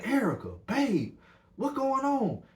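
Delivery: high-pitched calling of her name. Retold or imitated. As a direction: keep calling her, rising in worry. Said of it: "Marco steady calling her name".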